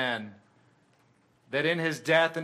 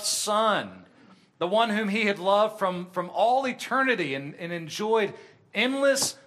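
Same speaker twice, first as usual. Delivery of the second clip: rising, louder sermon climax; impassioned preaching; 5 semitones higher, 3 dB louder than usual. Acted. Preacher lifting his voice to the congregation in passionate preaching. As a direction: preach it with mounting fervour.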